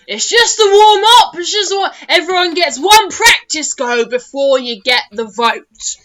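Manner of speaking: high, loud gnome shouting across the lake. Acted. Gnome announcer shouting to the crowd, high and loud. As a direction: bellow it like an eager little gnome. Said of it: "one gnome shouting".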